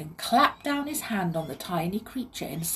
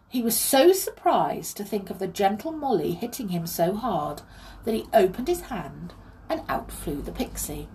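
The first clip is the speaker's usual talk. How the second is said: ordinary delivery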